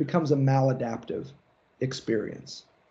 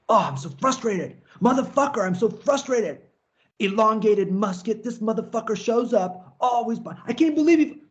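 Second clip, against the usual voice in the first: higher, strained comic whine; whiny frustrated guy; 8 semitones higher than usual. Acted. Lecturer mocking people whose big ideas never work out, higher comic whine. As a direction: ham up the bitter wannabe who missed out. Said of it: "spend their lives in a state of".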